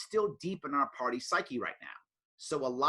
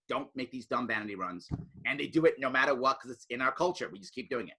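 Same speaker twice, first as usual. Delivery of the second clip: ordinary talk, nothing marked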